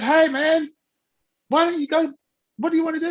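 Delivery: high, upbeat retold greeting; eager street pitch. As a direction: hail someone with eager questions. Acts himself approaching people on street.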